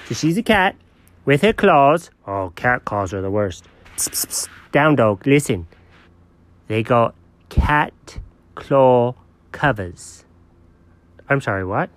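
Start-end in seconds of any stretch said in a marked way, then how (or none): 4.0-4.5 soft, whispered psst-psst-psst. Pretend. Friend calling the dog to order, whispered psst. Getting the excited dog's attention. 6.7-10.2 slow, lower, word-by-word announcement; slow reveal. Pretend. Dog revealing what the cat got, slow portentous delivery.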